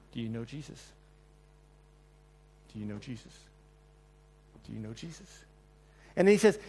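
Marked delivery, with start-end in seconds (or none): 0.1-3.4 hushed, repeated question; a soft interrogator's question; quiet questioning. Acted. Quoted interrogation, dropped very soft. 4.7-5.4 hushed, repeated question; a soft interrogator's question; quiet questioning. Acted. Third asking, softest.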